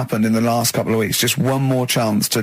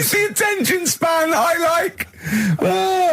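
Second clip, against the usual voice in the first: laughing. Real, not acted. Mock remark during Carl's story.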